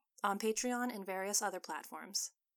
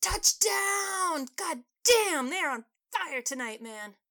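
high loud bellow that trails off by "man"; booming fan voice; 9 semitones higher, 9 dB louder, much slower than usual. Acted. Fan in the dining room booms over a touchdown, loud and high. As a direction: roar it across the room. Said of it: "a booming voice from the dining room".

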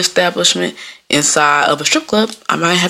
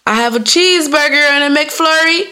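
higher order-counter call; 9 semitones higher than usual. Acted. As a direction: call the order out to waiting customers. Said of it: "I have to keep yelling".

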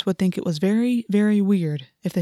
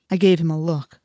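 ordinary delivery, nothing marked

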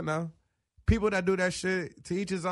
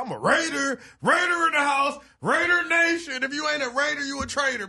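shouting; 8 semitones higher, 6 dB louder than usual. Real, not acted. Fan chanting his team pride.

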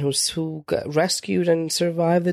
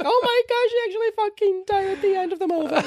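much higher, laughing disbelief; mock shock. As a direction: squeal the discovery in shock. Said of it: "I'm like".

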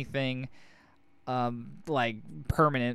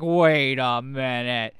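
slow, louder mock realization; dawning suspicion; 5 dB louder, much slower than usual. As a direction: drag it out as the penny drops. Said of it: "and they're like".

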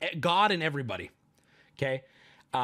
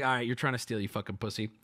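lowered menace; 7 semitones lower, 5 dB quieter, faster than usual. Retold or imitated. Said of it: "my dad's like".

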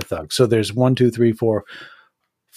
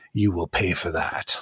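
low, soft threat; menacing undertone; quiet menace; 7 semitones lower, 6 dB quieter than usual. Acted. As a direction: promise payback, low and quiet. Nurse's gaze promises revenge.

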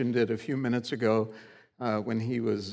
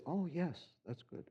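soft, lightly imitated Indian accent; 3 semitones higher, 13 dB quieter than usual. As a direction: agree quietly with a hint of an Indian accent. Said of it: "in his Indian accent".

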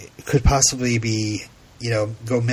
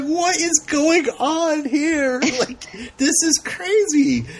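much higher, slow squealing disbelief through laughter; incredulous laughing; slower than usual. Retold or imitated. Host recalling her reaction, laughing incredulously. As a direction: lose it laughing at the scene. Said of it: "I'm just like".

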